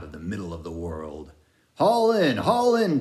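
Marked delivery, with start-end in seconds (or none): 1.7-3.0 high, loud shouted command; bellowed order. A narrator's reading. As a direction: roar the order across the deck. Ahab bellows orders at sailor.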